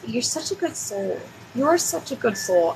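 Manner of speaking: soft, tender, almost hushed praise; tender reassurance. Real, not acted. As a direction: softly reassure a worried client. Comforting a stressed client.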